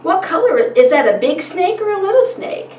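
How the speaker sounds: put-on high, animated questions to a child; eager parent prompting. Shown as a demonstration. Parent asking a child about the snake, eager. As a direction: quiz a little one with bright-eyed interest. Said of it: "And you would say".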